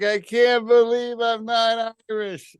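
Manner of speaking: high, slow, drawn-out lament; mock disappointed wail. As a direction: ham up a crushed wife's disbelief. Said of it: "She was so disappointed".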